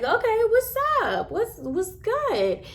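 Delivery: high-pitched, cocky squaring-up; acted challenge. Acts out standing up to fight.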